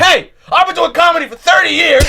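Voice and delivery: very high, loud imitation of a furious comic; bugging out. Old comic explodes at host in hallway, mock furious shouting. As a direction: shout it like an outraged veteran losing it. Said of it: "bugs out a thousand times more".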